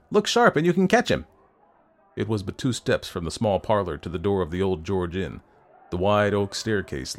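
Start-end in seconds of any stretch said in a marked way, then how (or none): 0.1-1.3 high, loud hurry-up call; urgent cry. A narrator's reading. Landlord hurrying the man to catch the doctor, urgent cry. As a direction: urge them on at a high pitch. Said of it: "cried the landlord".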